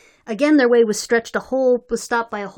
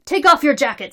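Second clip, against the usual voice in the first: stern command; 4 semitones higher, 7 dB louder than usual. A narrator's reading. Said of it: "said Terrace at length".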